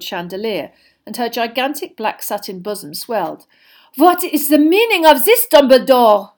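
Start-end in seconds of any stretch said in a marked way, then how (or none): 4.0-6.3 high, loud, commanding demand; haughty and forceful, voice raised; imperious. A narrator's reading. Headmistress demanding an explanation from Dumbledore, imperiously. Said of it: "she said imperiously".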